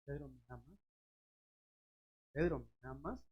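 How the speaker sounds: soft, hushed asking; a near-murmured tender question; Jesus softly asking Pedro if he loves him; Jesus asking gently. Acted. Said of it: "el Señor se lo preguntó tres veces a Pedro".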